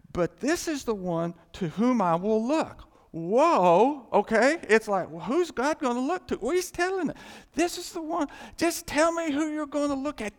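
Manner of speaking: loud, high-pitched pulpit excitement; excited preaching. Real, not acted. Preacher thrilled by the verse, excited full-force preaching. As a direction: seize on the verse and drive it up high.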